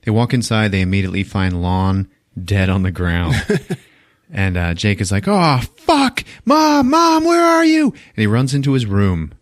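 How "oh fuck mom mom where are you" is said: high panicked shout; retold boy screaming for mom; frantic yelling. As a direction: call out for mom in alarm. Jake panicking, searching for his mom.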